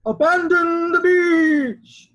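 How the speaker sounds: loud, slow, commanding shout; lifeguard's order. A narrator's reading. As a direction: bellow it like a lifeguard clearing the beach.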